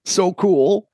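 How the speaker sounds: high, breathless so cool; mock awed boy. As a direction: ham up a teen boy's wonder.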